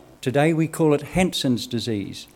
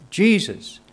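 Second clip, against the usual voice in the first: loud calling out; 8 semitones higher, 6 dB louder than usual. Acted. Lepers call out to Jesus.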